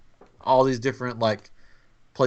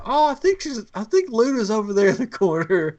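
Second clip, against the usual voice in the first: high, louder, breezy fib; covering for his son; 11 semitones higher, 4 dB louder than usual. Retold or imitated. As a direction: cheerfully pretend all is fine. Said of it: "I'm like".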